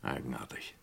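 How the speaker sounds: very soft, low aside; musing to himself. Acted. Inspector doubting the suspect's answer, musing quietly. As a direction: think it aloud, barely audible.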